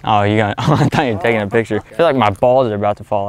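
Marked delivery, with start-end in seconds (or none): none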